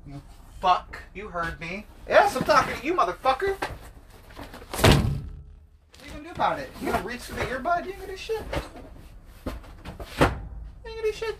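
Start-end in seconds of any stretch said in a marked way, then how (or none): 2.1-11.4 much higher, sing-song taunting; teasing a listener who won't switch off; mocking taunt. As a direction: needle the listener in a high mocking voice.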